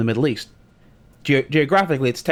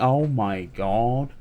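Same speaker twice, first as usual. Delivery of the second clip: disbelief; much slower than usual. Retold or imitated. Retold inner disbelief.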